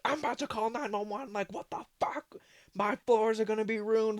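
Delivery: imitating mom losing it over the floors; hysterical mom. Imitating mom ranting about calling nine one one, hysterical.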